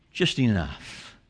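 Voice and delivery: low, slow and soft; hushed finish. Acted. Preacher closing the list of suffering, hushed. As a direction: drop right down and let it settle. Quiet close of the shouted list.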